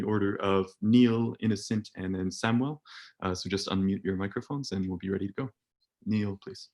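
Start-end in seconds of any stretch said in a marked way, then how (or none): none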